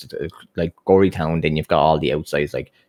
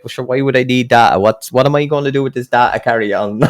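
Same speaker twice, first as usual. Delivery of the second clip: raised, louder retold protest; mock exasperation; 6 semitones higher, 4 dB louder than usual. Country customer refusing free data from salesman, mock exasperated. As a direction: imitate a customer dismissing newfangled nonsense. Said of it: "he must have ripped into the poor young lad".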